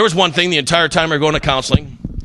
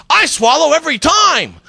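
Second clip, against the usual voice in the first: high, slow yelled boast from the crowd; girl yelling out; 10 semitones higher than usual. Retold or imitated. Tiffany from Tucson yelling out to the comic, high slow shout. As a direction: shout it proudly across the room. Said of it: "yells out".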